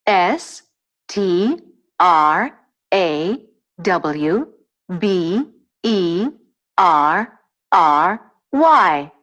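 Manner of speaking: letter by letter with small gaps, each lifting till the last; spelling out. Shown as a demonstration. Answering how to spell strawberry, spelled out letter by letter. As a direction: dictate the letters one at a time.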